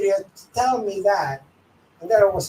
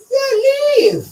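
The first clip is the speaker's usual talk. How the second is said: high, loud scornful send-off; sneering high command; mocking dismissal; 9 dB louder than usual. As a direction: shoo them out, pitched up and loud. Mocking boss telling her to leave.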